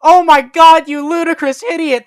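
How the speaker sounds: high, loud furious yell; angry shout. A narrator's reading. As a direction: scream it at them, outraged. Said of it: "They both shouted angrily".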